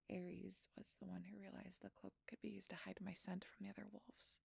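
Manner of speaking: hushed, breathy whisper throughout; a frightened secret whispered to the doctor; whispered confession. Acted. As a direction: murmur the story as if guards could hear.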